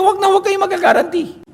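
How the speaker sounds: high-pitched emphatic warning; a father's high, insistent no; high quoted warning. Retold or imitated. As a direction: pitch it way up to warn them off. Quoting the warning.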